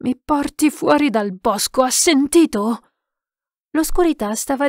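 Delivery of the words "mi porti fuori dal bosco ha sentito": higher, urgent order; insistent demand. A narrator's reading. Lost man to silent gamekeeper, demanding and desperate. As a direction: press for an answer.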